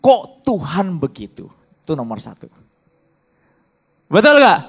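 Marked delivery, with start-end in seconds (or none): 4.1-4.6 building loud grumbling questions; imitating church complaints; mock complaint. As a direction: rant like a sulky churchgoer. Mimicking complaints, rising.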